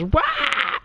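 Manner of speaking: very high squeaky "Wow!" shouted up high; Crash Bandicoot wow. Retold or imitated. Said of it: "Jared goes".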